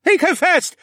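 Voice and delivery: high loud screaming chant; wild crowd scream. A narrator's reading. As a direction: scream it like a mob. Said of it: "the audience screamed wildly".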